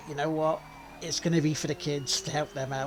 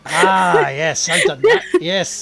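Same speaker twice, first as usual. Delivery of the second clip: laughing; 13 dB louder, slower than usual. Real, not acted. Loud laughing agreement.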